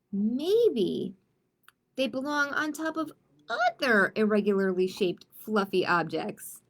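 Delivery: lively picture book read-aloud; story narration. Acted. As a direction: read it to the kids with playful energy.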